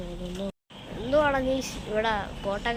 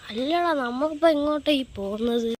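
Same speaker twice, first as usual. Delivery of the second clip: loud demanding question; 4 semitones higher, 7 dB louder than usual. Acted. Demanding where they are going.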